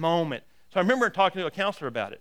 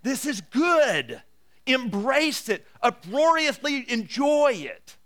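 louder, higher, driving sermon voice; full-force preaching; 4 semitones higher, 6 dB louder than usual. Acted. Pastor urging his congregation, in full-force preaching. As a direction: preach it to the back row with fire.

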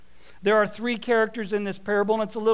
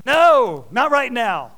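loud refusal; 3 semitones higher, 8 dB louder, slower than usual. Acted. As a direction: bark it back at the kids. Voicing himself refusing kids.

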